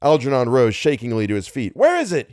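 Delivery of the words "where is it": high-pitched anxious call; urgent shout. A narrator's reading. Algernon searches for the monster, shouting. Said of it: "he shouted".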